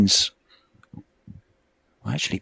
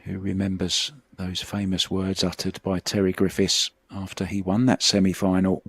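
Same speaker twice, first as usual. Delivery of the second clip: ordinary delivery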